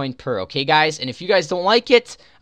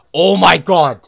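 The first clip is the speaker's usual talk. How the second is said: excited; 2 semitones higher, 10 dB louder, slower than usual. Real, not acted. Reacts to rare prize.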